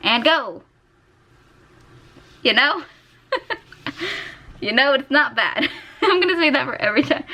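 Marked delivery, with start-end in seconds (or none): 2.4-7.2 laughing through the words, pitched up. Real, not acted. Artist laughing at her own blind drawing, giggling. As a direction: giggle while you say it.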